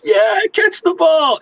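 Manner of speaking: high, slow, loud mock cheer. Fans cheering someone whose costume flopped, high slow mock cheer. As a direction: cheer them on like a kid's parent.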